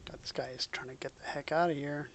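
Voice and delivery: ordinary delivery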